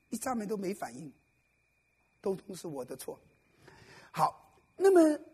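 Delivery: ordinary delivery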